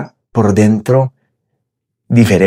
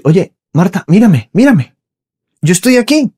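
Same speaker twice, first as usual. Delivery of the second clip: tender plea; 8 semitones higher than usual. Pretend. Jesus voiced pleading to Marta.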